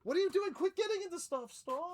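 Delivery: scolding the cat. Real, not acted. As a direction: yell at the cat getting into stuff.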